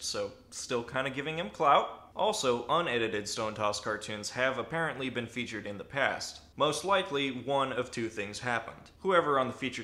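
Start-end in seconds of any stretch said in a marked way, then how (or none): none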